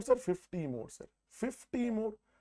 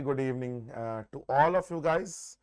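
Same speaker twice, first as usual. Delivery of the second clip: Indian English, retroflex t and d; north indian accent; 3 dB louder than usual. Real, not acted. Indian teacher's own live class.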